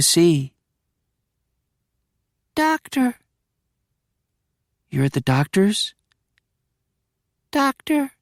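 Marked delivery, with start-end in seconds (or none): 2.5-3.2 put-on higher, little boy's voice; small child. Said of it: "My voice, high-pitched". 7.5-8.1 put-on higher, little boy's voice; small child. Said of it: "My voice, high-pitched".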